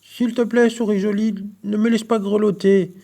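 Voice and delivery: high, pleading frog voice; stammering plea. A narrator's reading. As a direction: beg the mouse, a little unsure. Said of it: "elle bredouille".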